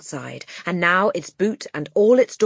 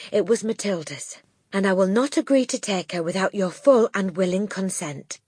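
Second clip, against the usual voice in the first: earnest teacher; 6 semitones higher than usual. Acted. Miss Honey pleads.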